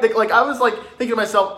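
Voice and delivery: ordinary delivery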